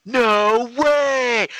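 shrill shouted "No way"; loud exclamation. Acted. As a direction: yell it like gossip on the phone. Acted phone call in theater.

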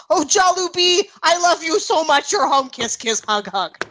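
a hint of a doting dad. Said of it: "her dad's just like".

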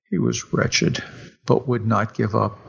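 ordinary delivery

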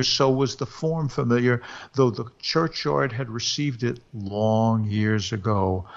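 plain reading voice

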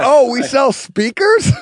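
high, loud, slow imitation of surprise; mock surprise. Joking as a PR guy about speakers, mock surprised. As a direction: play it astonished, drawn out and high. Joking mimicry of someone clueless.

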